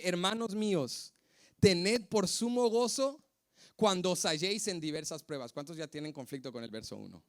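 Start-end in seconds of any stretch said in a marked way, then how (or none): 0.0-5.5 scripture reading. Acted. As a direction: read it like holy writ, stretching sumo.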